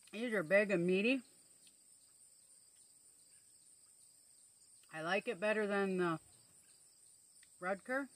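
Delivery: talking while chewing; speaking between bites; mouth full of tomato. Real, not acted. Seen 1: Tomato held at her mouth, biting and chewing throughout the words.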